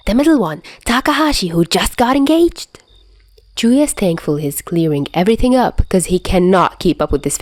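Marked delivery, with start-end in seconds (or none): 0.1-2.7 high, breathless gossip voice, over-eager; a wired friend spilling juicy gossip; high-pitched, giddy storyteller; hyper gossip. Acted.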